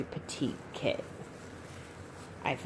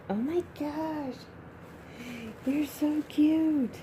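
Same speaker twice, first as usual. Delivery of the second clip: adoring coo; 9 semitones higher, slower than usual. Real, not acted. Collector to her new baby doll, cooing adoringly.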